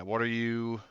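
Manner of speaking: ordinary delivery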